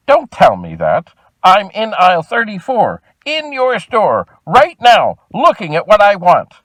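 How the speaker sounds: fed-up customer losing patience, slow and pointed; terse, exasperated. Retold or imitated. To the store clerk on the phone, a terse exasperated outburst. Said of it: "in a rather terse, quick, exasperated voice".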